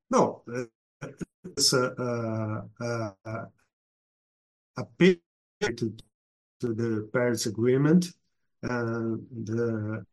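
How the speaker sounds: choppy, dropping-out call audio; breaking up audio. Real, not acted. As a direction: voice cutting in and out. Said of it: "[name], you're breaking up a bit".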